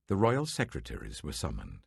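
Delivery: ordinary delivery, nothing marked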